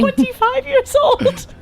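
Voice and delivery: slow, squeaky high voice. Pretend. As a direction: squeak it out slowly. Joking squeaky voice.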